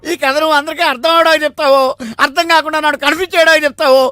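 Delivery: high-pitched, worked-up scolding; exasperated outburst. Acted. Listener fed up with a confusing story, exasperated outburst. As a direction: rant it as a threat.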